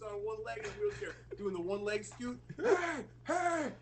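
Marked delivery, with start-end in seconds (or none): 2.6-3.7 man yelling hey. Retold or imitated. Stranger scooting out of woods at night, high call. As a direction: shout hey hey at the car.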